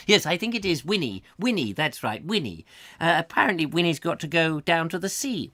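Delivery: a hint of a snooty old lady; lightly voiced prim gossip; snobbish neighbour. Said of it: "I've got to get out of character".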